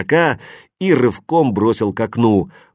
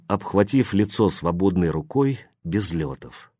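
usual speaking voice

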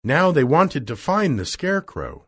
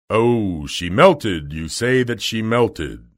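loud, pondering wizard; booming storybook wizard; Oz's voice; 2 semitones lower, 4 dB louder, slower than usual. Acted. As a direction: echo the news as a grand unseen ruler. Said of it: "said the voice".

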